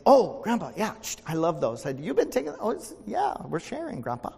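high, lightly voiced grandson caught out; a hint of a sheepish boy; grandson's voice. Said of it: "Jacob came in one day and said".

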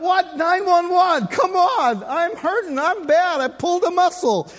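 high, loud, hammed-up protest; comic wail of an injured husband; pained pleading. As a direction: beg for help through mock agony. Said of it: "I'm in pain".

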